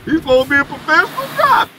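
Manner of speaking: high, fast mock-critic voice; mocking a viewer. Streamer mimics viewers who call his driving crazy, in a high fast mocking voice. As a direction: ham up a scoffing viewer judging his driving. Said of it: "For all y'all out there that".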